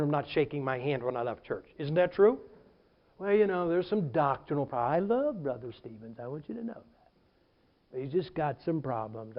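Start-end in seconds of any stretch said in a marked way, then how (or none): 3.2-9.4 low, soft feigned concern; mock sincere gossip. Former member discussing Brother Stevens, mock sincere concern. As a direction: lower it into confidential false kindness. Acted insincere gossip about the pastor.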